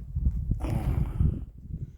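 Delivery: long tired exhale; weary sigh. Real, not acted. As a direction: sigh out in resignation. Resigned about the fire.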